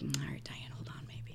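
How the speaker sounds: hushed, near-whispered reassurance; very soft, cool aside to his girl; level-headed. Retold or imitated. Nick Cage's character to Diane before stepping outside, level-headed and hushed. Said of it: "very level-headed".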